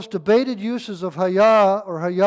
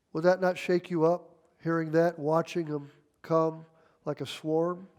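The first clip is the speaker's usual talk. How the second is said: ordinary talk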